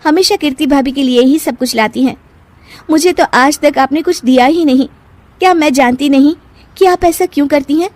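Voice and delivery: high-pitched reproach; aggrieved anger. Acted. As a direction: air old grievances at an elder. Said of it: "सास पर बहुत गुस्सा आता".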